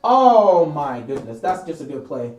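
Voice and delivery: very soft, stunned murmur; quietly crushed fan watching the replay; deflated awe. Real, not acted. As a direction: murmur to yourself in resigned disbelief.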